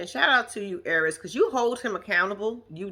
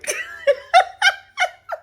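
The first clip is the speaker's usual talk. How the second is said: shrieking laugh, much higher; high burst of laughter; 5 dB louder than usual. Real, not acted. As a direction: cackle at the joke. Laughing at Lena's remark.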